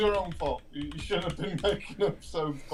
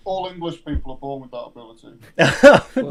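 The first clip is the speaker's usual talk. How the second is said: loud joking boast; 11 dB louder than usual. Real, not acted.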